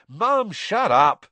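high, loud, drawn-out yell. A narrator's reading. Son silencing his shrieking mother, yelling. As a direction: yell over your mother to stop her. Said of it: "Ron yelled".

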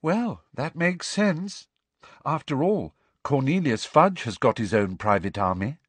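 Luna piping up. A narrator's reading. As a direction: float it out as an obvious fact. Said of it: "who piped up".

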